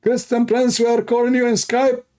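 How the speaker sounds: high, sing-song mocking taunt; taunting the dead man's coffin, high mock whine. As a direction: parody their call in a squeaky high voice.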